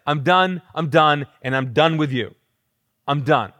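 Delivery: loud and finished with everyone; acted exasperation, falling hard on each ending; Jesus, tapped out. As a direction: hammer each phrase, utterly fed up. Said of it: "emotionally tapped out".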